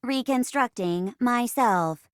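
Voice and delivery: even, deliberate computer report; robot assistant. Acted. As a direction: acknowledge orders like a ship's computer. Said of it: "I'm your robot assistant".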